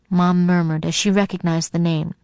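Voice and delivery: usual speaking voice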